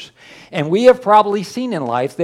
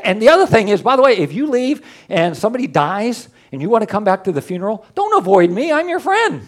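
normal talking voice

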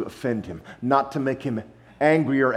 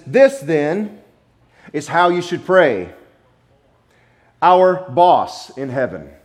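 mock misquote; 3 semitones higher, 6 dB louder, slower than usual. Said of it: "Wait, no".

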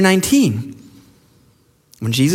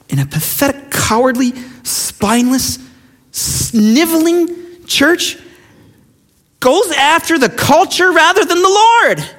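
slow, much higher sneering contempt; scornful sneer; 9 semitones higher, slower than usual. Real, not acted. Preacher scorning the cowardly church, slow and sneering. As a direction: drag out sniveling with scorn.

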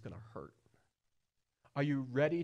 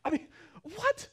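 mock surprise; 7 dB louder than usual. Preacher feigns outraged disbelief.

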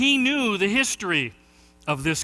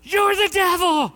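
much higher, loud heckler yell; shout; 12 semitones higher, 5 dB louder than usual. Retold or imitated. Heckler yelling at the preacher again, a loud high yell. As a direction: scream the accusation across the room. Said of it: "guess what he did again".

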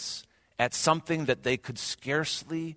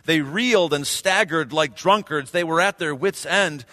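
loud, raised psalm reading; scripture reading; 5 semitones higher, 7 dB louder than usual. Acted. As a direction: proclaim the psalm with fervor. Reads psalm aloud in sermon.

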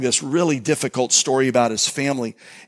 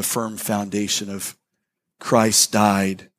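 closing prayer; 2 semitones lower, slower than usual. Real, not acted. Pastor praying over the congregation, slow and earnest. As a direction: pray it slowly, lowering the voice at the end.

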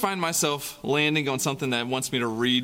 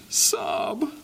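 whispered sob; 5 semitones lower than usual. A narrator's reading. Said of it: "never see his parents".

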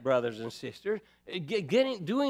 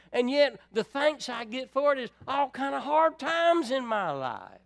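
raised, pleading complaint to God; a believer protesting to God in prayer, pitched up and pleading; 6 semitones higher than usual. Retold or imitated. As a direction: pour out your grievance to the Lord, pitched up. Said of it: "You ever had that talk with God?".

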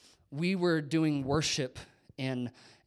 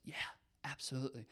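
hushed reply; 11 dB quieter, much faster than usual. Retold or imitated. Pastor's quiet reply acted.

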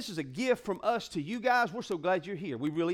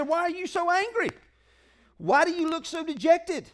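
higher, louder voice of the Lord; God's voice; 8 semitones higher, 4 dB louder than usual. Acted. The Lord questions angry Cain, higher and louder. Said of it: "So the Lord said".

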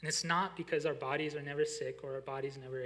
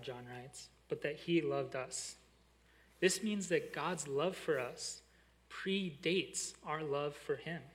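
ordinary talk, nothing marked

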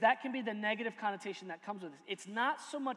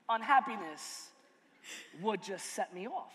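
ordinary delivery

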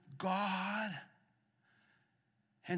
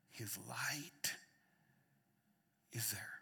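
whisper; 6 semitones lower, 8 dB quieter, slower than usual. Real, not acted. Whispered climax of sermon.

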